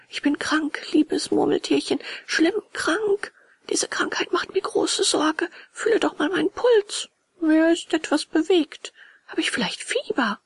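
high-pitched, whiny sick puppet voice; distraught puppet. Acted. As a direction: plead pitifully in a high little voice. (wehleidiger, kranker Hampelmann mit hoher Stimme)

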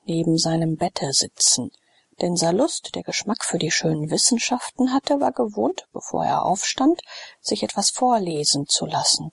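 plain reading voice

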